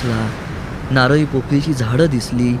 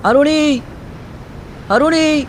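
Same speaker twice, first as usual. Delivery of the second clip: calling out; 14 semitones higher, slower than usual. Acted. Voices calling his name.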